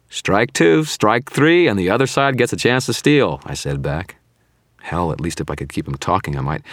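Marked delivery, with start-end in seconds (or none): 0.1-3.4 high, loud game-show taunt; mocking. A narrator's reading. Wounded wizard scoring the villain's guesses, mocking. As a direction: call it out like a mocking scorekeeper.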